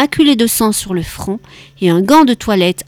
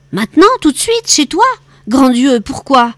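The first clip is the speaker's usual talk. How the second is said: high, loud and incredulous; startled disbelief; 4 semitones higher, 3 dB louder than usual. A narrator's reading. Charles to his brother on the phone, startled and incredulous. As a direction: caught off guard by a late-night summons. Brother summoned late at night.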